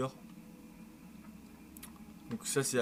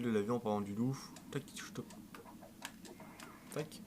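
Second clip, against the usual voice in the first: ordinary talk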